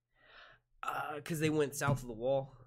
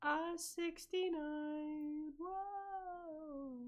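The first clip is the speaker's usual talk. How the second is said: silly high voice; 10 dB quieter, much slower than usual. Pretend. Movie quote voice.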